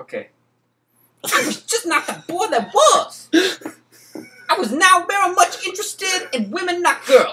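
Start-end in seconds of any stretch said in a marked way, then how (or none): 1.2-7.3 much higher, louder shouted put-on voice; jail inmate voice. Asked to sound like a drunk inmate, a loud high put-on shout.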